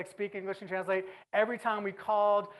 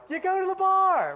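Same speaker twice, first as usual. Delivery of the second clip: pitched way up and louder, selling the answer; excited punchline; 10 semitones higher, 6 dB louder than usual. Real, not acted. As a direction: hit "the bar" like it's obvious. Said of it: "rhetorical punchline".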